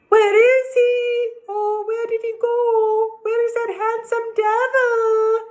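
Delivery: high, loud searching ladies; cried. A narrator's reading. Village ladies searching for Anthony, high loud cries. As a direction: call out frantically for him. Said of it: "cried the ladies from the village".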